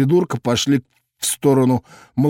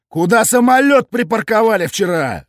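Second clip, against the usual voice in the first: high loud mock-stern bark; menacing bark; 8 semitones higher, 3 dB louder than usual. A narrator's reading. Cop grills drunks with a menacing bark. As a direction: bark it like a drill sergeant. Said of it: "грозно рявкнул я".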